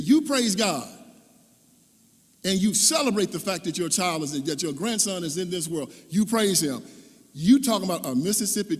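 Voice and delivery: loud, high stern rebuke; mother scolds him to praise God; scolding preach. Retold or imitated. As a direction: lay down the law to her son.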